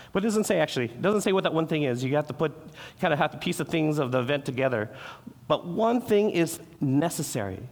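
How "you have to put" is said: usual speaking voice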